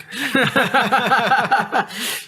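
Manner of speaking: high loud burst of laughter. Real, not acted. Laughing at own joke.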